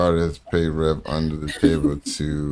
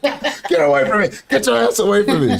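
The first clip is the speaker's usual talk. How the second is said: high, indignant yelling-off imitation; mad wife; 12 semitones higher than usual. Wife scolding husband for dry-firing at her, high mock fury. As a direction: play the furious wife shooing him off, pitched way up. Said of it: "She was so mad".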